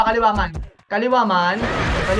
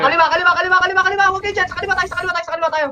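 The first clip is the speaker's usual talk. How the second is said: rally the team left, fast and urgent; urgent callout; 10 semitones higher, much faster than usual. Real, not acted. Shotcaller yelling teammates to go left, urgent callout.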